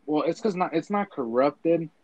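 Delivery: ordinary talk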